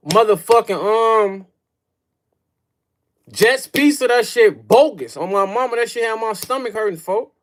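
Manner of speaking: high, loud disgusted rant; pizza rant. Real, not acted. Streamer ranting to chat about Detroit pizza.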